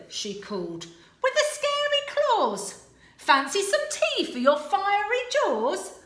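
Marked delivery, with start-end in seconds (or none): 1.2-6.0 high, loud and quick; eager princess, higher and louder; impatient Sue. Acted. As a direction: hurry him along. Sue addressing dragon.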